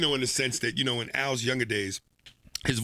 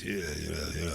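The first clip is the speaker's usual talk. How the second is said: low, growly [name] bark; 10 semitones lower, 8 dB quieter than usual. Acted. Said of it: "do D M X".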